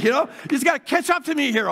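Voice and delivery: normal talking voice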